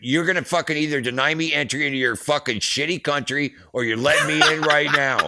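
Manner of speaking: loud, high angry ultimatum; fired up. Retold or imitated. Roadie demanding entry at the Canadian border, fired up. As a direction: build to a defiant yell on the last demand. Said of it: "get all fired up".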